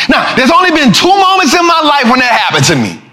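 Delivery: normal talking voice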